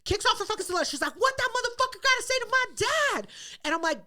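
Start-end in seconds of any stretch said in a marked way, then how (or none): 1.2-3.3 high-pitched irate demand; mock furious, voice pitched way up; imitated outrage. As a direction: act out a daughter spoiling for a fight. Angry daughter demanding answers.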